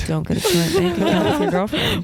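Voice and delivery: high, laughing repeat; laughing echo. Real, not acted.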